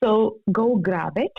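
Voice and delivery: ordinary talk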